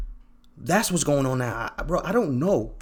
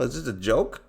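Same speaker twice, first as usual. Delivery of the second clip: normal talking voice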